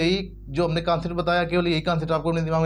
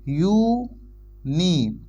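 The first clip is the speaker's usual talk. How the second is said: Uni stretched very slowly; drawn-out sound; much slower than usual. Shown as a demonstration. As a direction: draw out uni to show its first sound. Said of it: "इसका उच्चारण कैसे हो रहा है".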